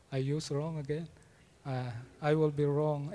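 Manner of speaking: ordinary talk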